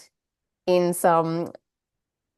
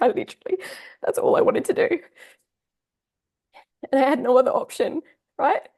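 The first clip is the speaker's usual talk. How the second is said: fighting back real tears as it spills out; real crying; 6 semitones higher than usual. Real, not acted. Tearful, telling viewers how drained she was, voice cracking. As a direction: voice breaking high, words half-whispered through tears. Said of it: "I'm gonna get emotional".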